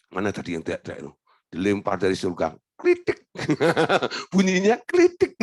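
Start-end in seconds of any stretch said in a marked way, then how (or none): 0.1-1.1 low, soft, fast aside; God looking for the noisy beggar, low and quick; brusque God. Pretend. God flings a coin down. 2.8-3.3 high little ting of a tossed coin; coin clink. Pretend. Sound of coin landing.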